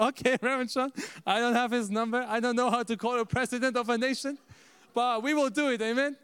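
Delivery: high, loud retold objection; flustered protest. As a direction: protest helplessly, then agree eagerly. Retold flustered reply to mentor.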